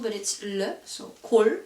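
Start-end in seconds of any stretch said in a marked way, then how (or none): 1.2-1.6 골 ending on L, no S; 골 with the S left silent; only L pronounced. Shown as a demonstration. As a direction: finish 골 on a clean L. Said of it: "you don't pronounce the S sound".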